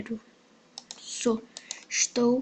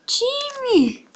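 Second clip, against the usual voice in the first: calling out; 11 semitones higher than usual. Real, not acted. Calling a player for team.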